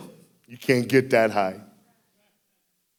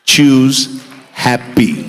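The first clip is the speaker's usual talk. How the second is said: very slow, loud, drawn-out delivery; drawn-out punchline; 10 dB louder, much slower than usual. Shown as a demonstration. Preacher landing the punchline to husbands, slow and loud. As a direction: stretch every word as the punchline. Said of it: "punchline advice to married men".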